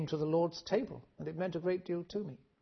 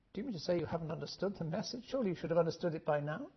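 fast, softer imagined voice of critics; quick imagined challenge; 3 dB quieter, faster than usual. Retold or imitated. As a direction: rattle off what people would say. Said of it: "people will say".

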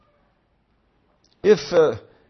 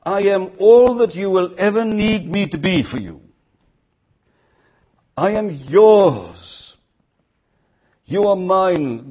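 tender promise; 6 semitones higher, 4 dB louder than usual. Acted. Preacher voicing God's tender promise.

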